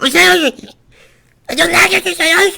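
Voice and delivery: very high, loud shrieking creature cry; screeching monster. Acted. Monster CEO screaming at the light from the door, very high and loud. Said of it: "he also screams in, like, a weird language".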